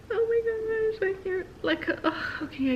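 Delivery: high, choked-up crying voice; on the verge of tears, overwhelmed; teary. Retold or imitated. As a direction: voice breaking with emotion. Overwhelmed by emotion, near tears.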